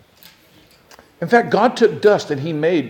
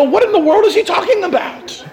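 mock bafflement; 12 semitones higher than usual. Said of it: "read this and go".

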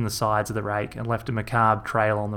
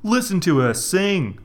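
higher, louder bloke voice, proud and oblivious; Rog's Aussie voice; 8 semitones higher, 3 dB louder than usual. A narrator's reading. Rog admiring the float, oblivious to the fuss. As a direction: play Rog as a cheery, clueless country bloke.

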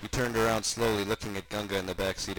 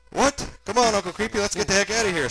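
put-on high, loud kid squeal; 11 semitones higher, 8 dB louder than usual. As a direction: squeal in alarm like a child. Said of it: "squealed Gunga".

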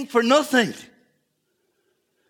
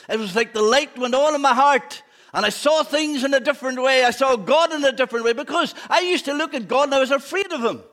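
raised, loud preacher's cry; fervent preaching; 6 semitones higher, 6 dB louder than usual. Acted. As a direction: preach it out with full force. Testimony preached at full force.

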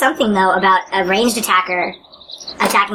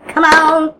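high, loud plea to the dice; excited urging; 7 semitones higher, 4 dB louder than usual. Real, not acted. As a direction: beg the dice loudly.

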